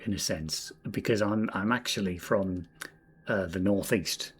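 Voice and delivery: ordinary delivery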